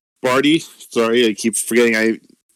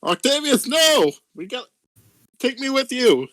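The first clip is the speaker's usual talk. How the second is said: high pleading call; 12 semitones higher than usual. Acted. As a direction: cry after him, high and desperate. Calling after runaway friend.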